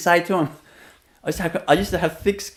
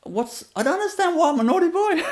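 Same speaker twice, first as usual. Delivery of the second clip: put-on high, puzzled child's protest; baffled child; 13 semitones higher than usual. Little boy baffled at a stranger's scolding, high puzzled voice. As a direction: sound totally confused by the scolding. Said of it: "I looked at her like".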